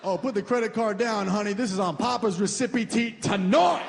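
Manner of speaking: high, slow swagger; boastful big spender. Pretend. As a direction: flaunt it, showy order. Showing off ordering pricey food.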